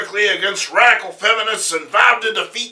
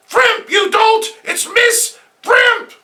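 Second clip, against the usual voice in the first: very high, loud shriek, slowing; shrill indignant woman; 6 dB louder than usual. Acted. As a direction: screech the correction. Villainess corrects the cop.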